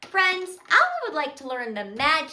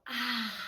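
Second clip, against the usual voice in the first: soft, low breathed-out "ah"; calming exhale; 3 semitones lower, 6 dB quieter than usual. Shown as a demonstration. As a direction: let the breath go gently. Models breathing out in story.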